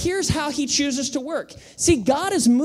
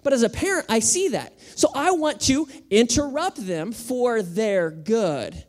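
ordinary delivery

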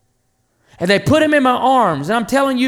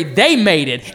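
ordinary delivery

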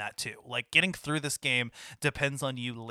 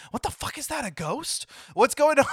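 high, freaked-out blurting; spooked player seeing a ghost; mock startled panic with a laugh; shocked disbelief; 12 semitones higher, 5 dB louder than usual. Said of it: "you're like".

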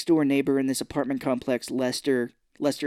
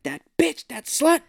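much higher, louder outraged shriek; mock outrage; 13 semitones higher, 5 dB louder, slower than usual. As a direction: spit out the insult in mock fury. Ex-husband raging at dead wife.

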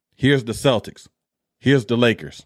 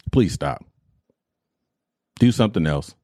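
usual speaking voice

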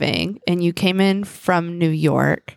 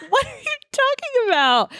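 incredulous. Retold or imitated. Retold baffled reply to 2 a.m. call.

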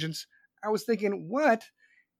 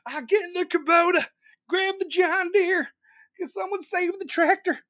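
high-pitched hammed-up country-boy panic; mock panic; 10 semitones higher, 4 dB louder than usual. Streamer mocking Texans in an ice storm, hammed-up panicked wail. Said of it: "fucking ice shows up, you're like".

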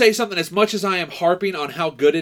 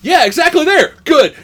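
high, loud mock approval; hammed-up excited answer; imitated excited confirmation; 9 semitones higher, 8 dB louder than usual. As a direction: act out an eager, emphatic reply. Acting out film character's reply.

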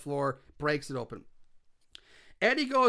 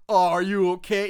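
louder, mocking fake concern; sarcastic; 5 dB louder than usual. Douche taunting someone he hurt, sarcastically. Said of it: "like a sarcastic douche".

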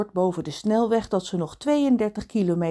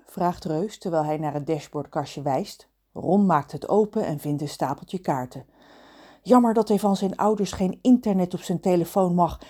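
plain reading voice

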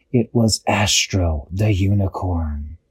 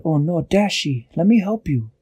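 concerned helper; 6 semitones higher than usual. A narrator's reading. Peso comforts hurt Dashi.

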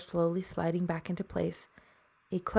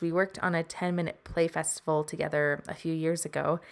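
usual speaking voice

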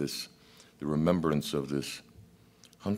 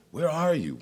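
much higher, louder cry of God; 14 semitones higher, 4 dB louder than usual. Acted. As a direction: cry out desperately searching. God calling again, higher.

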